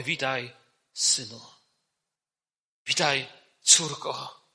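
soft, low fatherly greeting; tender welcome. Acted. Preacher voices God receiving believers as children, tender welcome.

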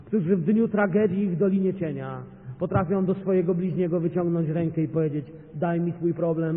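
loud, ringing pulpit delivery; fervent preacher voice; preaching at full force. Acted. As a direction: drive it home to the congregation.

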